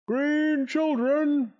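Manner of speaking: slow, booming, pitched high; booming male voice. Acted. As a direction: boom it out across the hall. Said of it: "boomed a voice".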